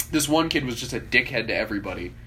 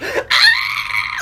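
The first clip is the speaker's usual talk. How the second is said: very high, loud yelp; imitated squeal of fright; mock scream; 5 dB louder than usual. Imitating the kid's cry when punched.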